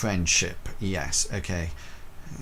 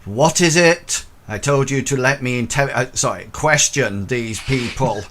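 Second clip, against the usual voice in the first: louder, higher, fast impatient demand; irritated inspector snapping; 4 semitones higher, 6 dB louder than usual. Acted. Inspector snaps at the constable who barges in. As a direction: bark at the intruder for interrupting. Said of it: "turns and says".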